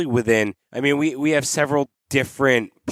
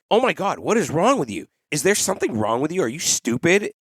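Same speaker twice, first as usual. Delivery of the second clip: mock belittling parent; 5 semitones higher than usual. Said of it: "Then there are the parents like".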